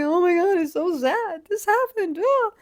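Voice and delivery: high, loud, hammed-up sobbing voice; mock tearful wail. Tearful girlfriend to her puzzled boyfriend, mock wailing high and loud. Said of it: "I'm like".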